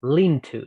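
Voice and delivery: "linto" with the l starting the word; l moved onto into. Shown as a demonstration. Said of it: "el sonido l perteneciera a into".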